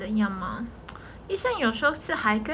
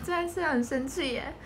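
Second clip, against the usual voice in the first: ordinary talk, nothing marked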